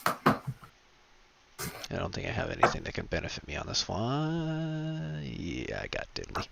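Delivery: very soft, low musing; hushed, resigned mumble; muttering to self. Real, not acted. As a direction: think aloud quietly while checking options. Muttering while checking character sheet.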